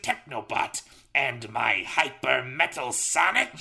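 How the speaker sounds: high, loud ranting villain; Robotnik's fiendish voice. Acted. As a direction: rant like a mad scientist. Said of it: "Robotnik's fiendish voice".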